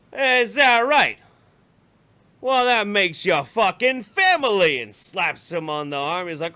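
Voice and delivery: hammed-up jolly uncle welcoming a new relative; boisterous uncle. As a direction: slap him on the back with your voice. Uncle Sven welcoming loudly.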